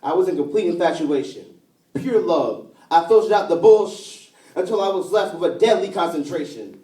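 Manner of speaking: rhythmic, emphatic poetry recital; spoken-word poem. Acted. Poet remembering New York with a lost lover, spoken-word style. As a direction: carry each rhyme through like a slam poet.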